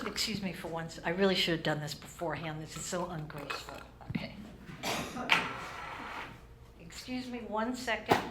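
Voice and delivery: far from the mic, quiet aside. Real, not acted. Comic pausing mid-show, aside away from microphone. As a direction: step back from the mic to apologise.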